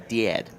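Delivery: "Dad" with an E slid in, like dee-ad. Retold or imitated. Mimicking a friend's Minnesota speech, "Dad" as dee-ad. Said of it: "There was, like, an E in it".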